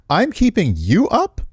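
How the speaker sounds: high, slow disbelieving echo; incredulous. Real, not acted. Player scoffing at the character's complaint, incredulous. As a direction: stretch it out in disbelief.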